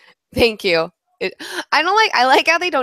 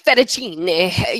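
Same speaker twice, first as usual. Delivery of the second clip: slow, drawn-out Italian "Fettuccine", every syllable rolled; mock chef pronouncing "Fettuccine" the Italian way; Italian accent; 2 semitones lower, much slower than usual. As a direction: lightly mimic a TV chef's Italian "Fettuccine". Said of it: "she's like".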